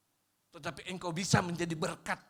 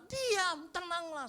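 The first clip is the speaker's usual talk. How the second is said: higher, emphatic verse; verse quoted high; 14 semitones higher, 5 dB louder, much slower than usual. Shown as a demonstration. Pastor quoting the verse to the congregation, high and emphatic. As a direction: proclaim the verse. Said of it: "Ayatnya bilang".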